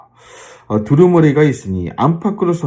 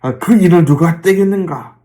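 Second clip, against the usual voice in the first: higher, projected proclamation; angel crying out; 5 semitones higher, 4 dB louder than usual. Retold or imitated. Preacher acts out the angel seeking an opener, crying out. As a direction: call it out like a herald angel. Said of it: "막 이렇게 외쳤다는 거예요".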